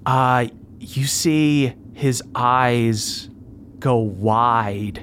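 usual speaking voice

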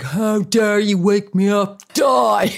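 loud, slow mock-furious teen; mock teen rage. Teen woken for school yelling at mum, loud mock rage. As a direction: roar like a woken teenage monster. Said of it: "mad creature".

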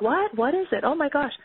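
imitating an excited, curious prospect; eager prospect. Acts out an eager prospect's reaction.

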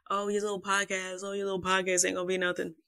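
sneering imitation of her brother; mock his dismissive tone, lightly. Brother teasing her about the podcast, lightly mocking. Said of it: "used to shit on me and used to be like".

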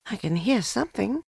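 put-on high, light child's voice; boy's voice. A narrator's reading. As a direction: say it as a listening little boy. Narrator voices boy Christopher Robin.

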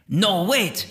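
high, urgent plea; sudden high-pitched second thought; same spirit voice. Acted. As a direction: call them back in a high voice. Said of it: "hear that same voice say".

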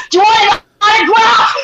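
high, very loud taunting offer; mock-eager shout. Guest taunts aggro people with autograph offer, shouting. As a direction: shout it teasingly through laughter. Acts taunting the angry man.

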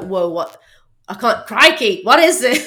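put-on a weak go at an Australian accent, building to a laugh; half-hearted Australian accent imitating Steve Irwin; bad Australian accent. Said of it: "I can't do an Australian accent".

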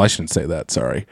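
dropping to almost nothing; a soft, sheepish apology fading away. Real, not acted. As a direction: trail off, embarrassed at the slip. Catches herself, backing off.

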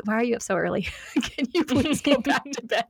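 laughing through a parent's plea; amused, half-hearted begging with giggles; mock pleading. Parent to little kids up too early, laughingly pleading. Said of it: "what I wanted to say, though".

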